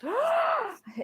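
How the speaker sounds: high sharp gasp; loud gasp. Acted. Player gasping as her character, shocked. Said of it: "Violet just gasped like this".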